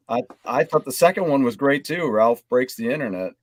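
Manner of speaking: usual speaking voice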